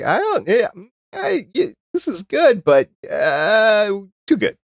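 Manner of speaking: high, pleading voice of an overstuffed mouth; mouth talking back. Acted. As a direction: play your mouth begging you to stop eating. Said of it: "but your mouth's like".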